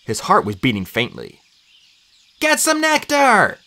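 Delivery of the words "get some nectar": high, urgent yell; drawn-out, high-pitched shout. A narrator's reading. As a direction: call out for medicine in alarm. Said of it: "I yelled".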